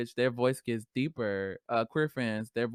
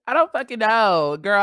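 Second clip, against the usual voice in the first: exasperated; 8 semitones higher, 9 dB louder than usual. Real, not acted. Drops the girl voice, gives up exasperated.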